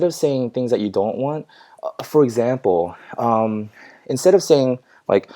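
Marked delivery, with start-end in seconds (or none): none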